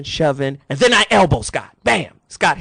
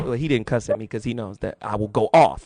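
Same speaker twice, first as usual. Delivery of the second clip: ordinary talk, nothing marked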